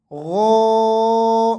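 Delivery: غو said thick and full, held long; heavy ghain. Shown as a demonstration. Teacher showing students how to thicken ghain. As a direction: make غو heavy and throaty. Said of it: "اس کو بھی آپ نے را کی طرح موٹا کر کے پڑھنا ہے".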